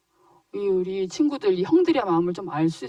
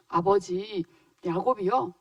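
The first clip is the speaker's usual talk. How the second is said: usual speaking voice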